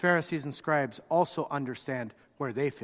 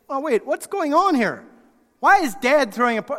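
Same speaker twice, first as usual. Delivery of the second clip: higher, louder, startled questions; surprised alarm; 9 semitones higher, 7 dB louder than usual. Acted. Older brother hearing the party, startled and higher. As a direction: catch the sound of a party, alarmed. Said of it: "gets a little closer and says".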